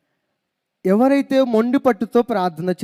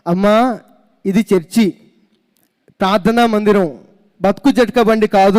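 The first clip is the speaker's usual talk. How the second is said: firmly raised voice, louder; a bit louder; 4 dB louder than usual. Retold or imitated. Pastor firmly tells the wrong-number caller, louder. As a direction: speak up firmly so she finally hears. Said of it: "అప్పుడు నేను కొంచెం గట్టిగా".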